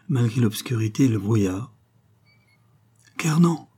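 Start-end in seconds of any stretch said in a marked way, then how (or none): 3.2-3.7 higher-pitched exclamation of recognition; sudden raised cry; startled cry. A narrator's reading. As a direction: call out a name in surprise. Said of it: "s'écria-t-il".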